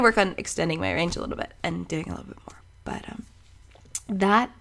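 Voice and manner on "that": ordinary delivery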